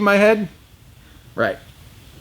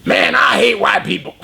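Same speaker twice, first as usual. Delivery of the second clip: deadpan put-on voice, a bit higher; inmate's retort; 3 semitones higher than usual. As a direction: toss it off in his voice. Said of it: "he looks at me and goes".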